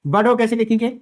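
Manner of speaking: usual speaking voice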